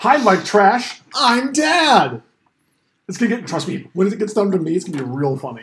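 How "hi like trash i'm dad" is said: punchline belted higher, proudly corny; hammy dad joke. Pretend.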